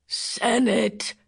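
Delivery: gasping. Acted. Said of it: "Bill managed to gasp".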